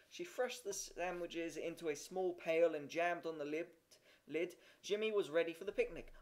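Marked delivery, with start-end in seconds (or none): none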